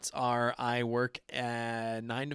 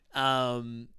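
ordinary delivery

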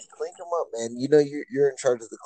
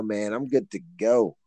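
normal talking voice

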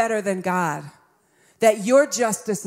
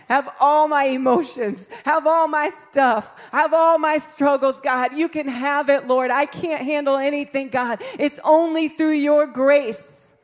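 raised, impassioned plea to God; fervent prayer; 5 semitones higher, 4 dB louder than usual. Acted. Preacher handing struggles over to God, fervent prayer. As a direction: cry out in surrender, pitch lifted, pressing each phrase.

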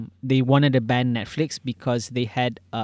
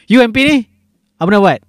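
loud mock question; 8 semitones higher, 9 dB louder, faster than usual. Mocking a politician's question.

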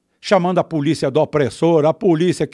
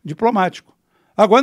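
usual speaking voice

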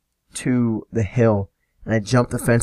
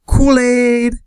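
very high, loud mock blurt; panicked blurt, imitated; 13 semitones higher, 5 dB louder than usual. Friend with no answer for the cop, imitated panicky blurt. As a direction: blurt the first thing that comes to mind. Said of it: "does not know what to say".

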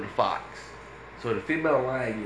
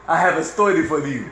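mock Indian accent; 3 semitones higher, 4 dB louder than usual. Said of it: "Stop doing the accent".

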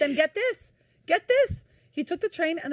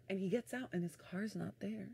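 much softer, dropping to a hush; hushed reveal; 13 dB quieter than usual. Real, not acted. Telling how the van vanished, in a hush.

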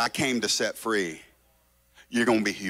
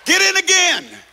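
high loud insistent get in again, in stressed; insistent command; 8 dB louder than usual. Acted. Preacher insisting to the congregation, loud and high. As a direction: drive the word in home.

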